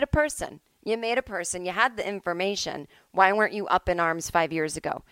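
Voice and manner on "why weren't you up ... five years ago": ordinary delivery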